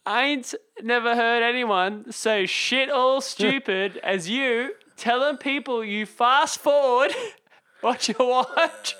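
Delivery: slow, high outback drawl, laughing through it; broad bush Australian, hammed-up; mock Aussie country accent. Host reading listener's insulting email in mock outback Australian accent. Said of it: "out in the country accent".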